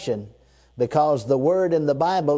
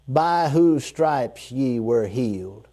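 slower and lower, letting it sink in; slow, settling; 3 semitones lower, slower than usual. Shown as a demonstration. Preacher repeating the verse so it sinks in, slow and low. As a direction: let the line settle on them. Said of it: "Now stop right there. Let that settle".

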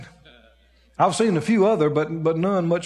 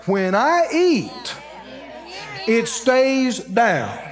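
very slow, each word drawn out; defiant declaration; much slower than usual. Retold or imitated. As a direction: stand up and proclaim it slowly and firmly. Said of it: "you stand up and you say".

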